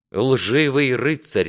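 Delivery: high, loud, slow self-condemnation; penitent prince. Acted. Prince kneels before his father, impassioned confession.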